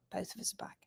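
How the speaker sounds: hushed whisper. Shown as a demonstration. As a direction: whisper it secretly. Whispered aside then repeated.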